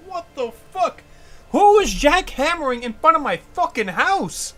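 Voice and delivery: high, loud incredulous rant at the noise; outraged yell. Retold or imitated. Woken by construction, yelling at the workers in outrage. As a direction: rage like you've just been jackhammered awake.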